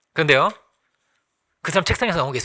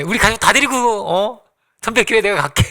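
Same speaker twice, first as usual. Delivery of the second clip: eager pleading junior; 5 semitones higher, 5 dB louder than usual. Retold or imitated. Junior begging father to help.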